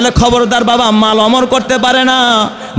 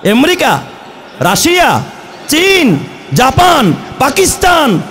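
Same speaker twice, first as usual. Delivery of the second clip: shouting; 5 semitones higher than usual. Real, not acted. Preacher lists nations rhetorically.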